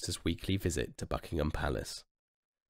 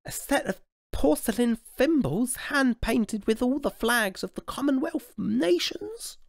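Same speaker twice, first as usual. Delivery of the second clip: put-on high little baby voice; royal baby voice; 4 dB louder than usual. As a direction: ask like an eager small child. Royal baby guesses a present.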